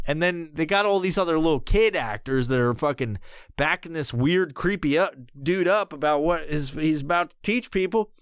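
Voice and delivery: ordinary talk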